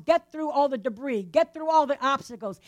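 ordinary delivery

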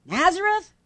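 high, rising disbelieving question; incredulous exclamation. Acted. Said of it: "exclaimed Na-, Nathanael".

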